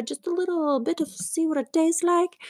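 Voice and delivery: normal talking voice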